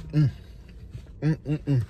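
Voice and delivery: humming while chewing; savoring food mmm sounds; mouth full. Real, not acted. Seen 4: mouth closed and working, eyes down, food in hand between bites.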